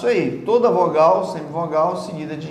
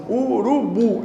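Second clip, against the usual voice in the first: "urubu" chanted syllable by syllable, higher; clapped-out syllables; 5 semitones higher, slower than usual. Shown as a demonstration. Teacher mimicking people who clap out words, "urubu" in beats. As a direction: beat out each syllable of "urubu" separately. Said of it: "bate palma assim".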